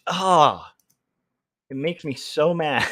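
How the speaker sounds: ordinary talk, nothing marked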